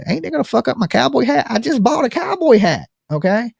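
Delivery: high-pitched, put-out complaint; indignant protest. Acted. Rider objecting to a helmet over a new cowboy hat, indignant.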